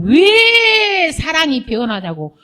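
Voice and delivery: imitated protest with a long wailed WHY; mock lament. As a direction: draw out the why in mock complaint. Acting out a demanding lover.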